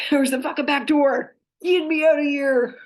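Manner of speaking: high, loud play-acted demand; comic desperate outburst; mock panicked escape. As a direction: shout it like bolting for the exit. Acting out wanting to flee.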